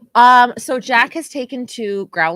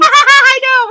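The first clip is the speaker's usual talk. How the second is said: laughing; 14 dB louder than usual. Real, not acted. Laughing reply.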